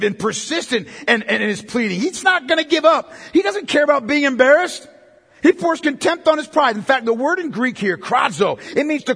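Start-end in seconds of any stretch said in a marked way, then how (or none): 2.0-6.7 loud, high passionate preaching; fervent preaching. Acted. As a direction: preach it with rising fire. Preaching at full force.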